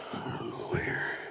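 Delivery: whispered aside. Real, not acted. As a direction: mutter it under breath. Unvoiced aside.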